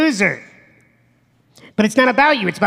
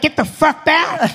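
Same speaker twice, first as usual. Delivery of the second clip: mock snap; 7 semitones higher than usual. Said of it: "I'd be like".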